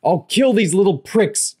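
high, loud murderous scream; enraged crook screaming threats at two kids; furious shout. A narrator's reading. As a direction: scream it as you lunge for his throat. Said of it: "Joe shouted".

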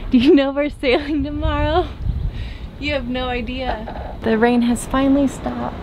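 normal talking voice

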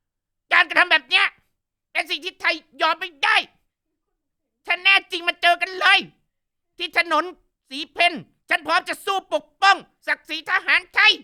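fiery defiant speech. Retold or imitated. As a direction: rouse the crowd with a mock war cry. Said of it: "แม่ลีน่าจังบอกว่า". (เลียนเสียงปราศรัยดุดัน เสียงสูงและดังขึ้นมาก)

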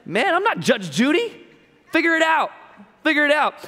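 high, loud and dismissive; teacher waving off bickering students, high and loud; fed-up teacher. Pretend. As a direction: brush them off, exasperated. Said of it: "I'm just saying".

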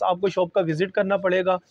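ordinary delivery

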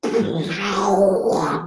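loud, high vocal roar of a band; imitated deafening blast. Musician mimicking Motorhead coming out to play, huge roar. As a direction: explode into a wall-of-sound noise. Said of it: "like the loudest shit you ever heard".

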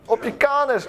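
high-pitched gleeful battle shout; triumphant attack cry. Real, not acted. Player landing a hit on a game enemy, triumphant cry. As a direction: call out the hit as it lands.

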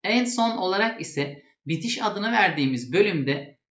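normal talking voice

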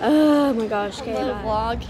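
usual speaking voice

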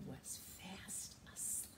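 hushed whisper so he won't wake. Acted. Storyteller whispers the ending so Squirrel sleeps. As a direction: whisper softly, he's sleeping.

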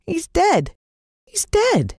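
put-on high, breaking voice of a grieving boy. Jalon tells his friend his dog is dead, high and upset. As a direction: cry it out, voice cracking upward. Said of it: "Jalon looked forlorn".

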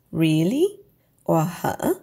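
rising like a question; questioning rise. Shown as a demonstration. Teacher shows the question tone by example. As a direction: ask it, voice going up. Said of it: "your voice goes up like you're asking a question".

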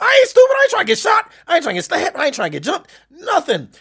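high, loud protesting agreement; comically quick to agree, voice shooting up; loud, high-pitched surrender; emphatic backing down. Retold or imitated.

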